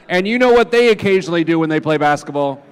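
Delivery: ordinary delivery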